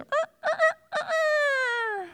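very high crowing rooster call; hammed-up cock-a-doodle. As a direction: crow like a rooster at dawn. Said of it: "the rooster crow".

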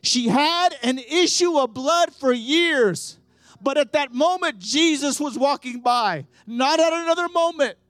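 high, loud revival-preacher delivery; full-force preaching. Real, not acted. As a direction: drive each phrase out forcefully to the crowd. Preaches the healing stories at full force.

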